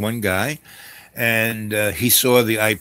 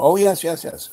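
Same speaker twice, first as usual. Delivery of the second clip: higher, louder mock assurance; eager reassurance; 7 semitones higher, 5 dB louder than usual. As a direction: imitate someone bluffing with quick eager agreement. Bluffing about her experience.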